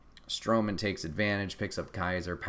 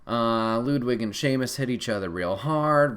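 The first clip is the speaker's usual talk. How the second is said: ordinary delivery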